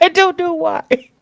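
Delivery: helpless giggle, wheezing the words; still laughing. Real, not acted. Laughing at the insult.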